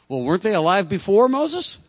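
high, loud mock bewilderment; puzzled objection. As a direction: blurt it out as a comically baffled question. Acting out his own puzzled objection.

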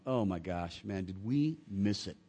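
low, rueful head-shake; John regretting what they missed. Retold or imitated. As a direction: sigh it out in regret. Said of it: "shaking his head like going".

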